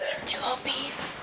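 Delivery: soft, voiceless whisper; hushed teasing whisper; whispered aside. Real, not acted. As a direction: murmur it so only one person hears. Whispered aside about the pet.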